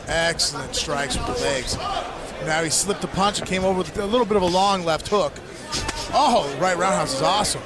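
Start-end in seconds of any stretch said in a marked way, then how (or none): none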